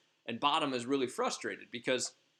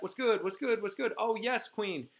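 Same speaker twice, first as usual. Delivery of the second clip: high, louder chirpy servants answering the call; eager fairy servants; 10 semitones higher, 3 dB louder than usual. Retold or imitated. Fairy servants answering their queen in high chirpy voices. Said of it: "and they're like".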